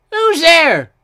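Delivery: high, loud challenge; loud, high-pitched little engine; startled call. A narrator's reading. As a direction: call out sharply into the dark. Startled engine calls into dark.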